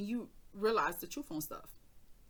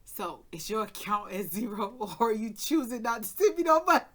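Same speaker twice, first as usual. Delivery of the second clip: sister mimics mom's blunt question, getting louder into laughter; imitating mama; 4 semitones higher, 10 dB louder than usual. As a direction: deliver mama's jab, cracking up at the end. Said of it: "that sound like mama".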